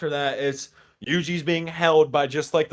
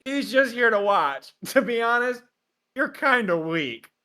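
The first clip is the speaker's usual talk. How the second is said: higher, slow mock taunt; hammed-up teasing; teasing Gojo; 9 semitones higher, slower than usual. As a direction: drawl the insult. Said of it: "Gojo was like".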